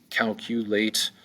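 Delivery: "calculate" slowly in three beats, CAL-CU-LATE; syllable by syllable. Shown as a demonstration. Teacher counting syllables for learners, "calculate" slowly sounded out. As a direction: stretch out each syllable of "calculate". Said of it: "Calculate has three syllables".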